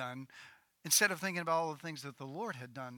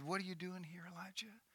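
quiet voice; 2 semitones higher, 16 dB quieter than usual. Acted. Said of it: "a voice came to him and said".

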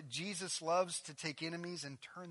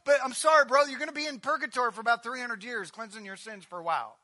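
higher, louder, rushed sham sympathy; mock apologetic gatekeeper; 9 semitones higher, 13 dB louder than usual. Pastor parodying a religious gatekeeper, breezy mock pity. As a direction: brush him off with fake kindness. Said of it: "He says".

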